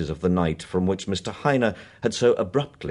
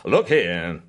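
louder, firm protest; raised voice; 6 dB louder than usual. Acted. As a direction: raise the voice to object. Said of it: "raising his voice".

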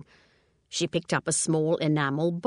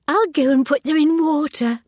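much higher, louder, bright and cheery; breezy as if nothing were wrong; sing-song cheerful exit; cheerfully; 10 semitones higher, 9 dB louder than usual. A narrator's reading. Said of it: "said Anthea cheerfully".

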